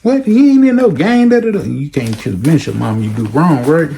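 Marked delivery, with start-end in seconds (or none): 0.0-1.6 much higher, brash ranting mother; angry mom. Retold or imitated. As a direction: tear into the principal. Mother defending her son.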